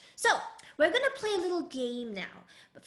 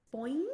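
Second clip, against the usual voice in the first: "Boing." gliding upwards like a spring; rising second tone; 2 semitones lower than usual. Shown as a demonstration. Teacher pictures a spring for children, "Boing." rising. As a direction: let "Boing." spring up in pitch. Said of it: "think of a spring going upwards".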